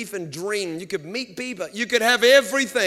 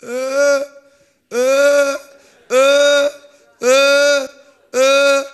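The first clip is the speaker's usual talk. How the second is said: loud, higher choking uh bursts; sputtering engine; 4 semitones higher, 10 dB louder, much slower than usual. Retold or imitated. Preacher imitating a go-kart governor cutting in, loud sputter. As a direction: mimic a stalling engine. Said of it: "this is what starts happening".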